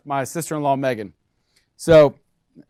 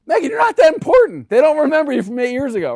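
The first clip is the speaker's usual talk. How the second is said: much higher and louder, teasingly scolding; mock exasperated scolding; 10 semitones higher, 7 dB louder than usual. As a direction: lecture Megan with mock outrage. Scolding his sister-in-law, mock exasperation.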